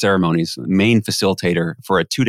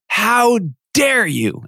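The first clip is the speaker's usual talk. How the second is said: mock angry yell; 13 semitones higher, much slower than usual. Man from front table confronts the MC, imitated yelling. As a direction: imitate an outraged man, stretching each word. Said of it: "he starts yelling at me".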